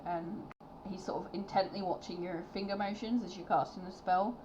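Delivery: normal talking voice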